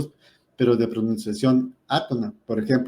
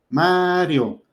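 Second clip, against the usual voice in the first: "Mario" with a long, high A, then falling; stress on the A; 5 semitones higher than usual. Shown as a demonstration. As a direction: stretch the first syllable, MAAA-rio. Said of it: "la fuerza de la voz está cayendo en la A".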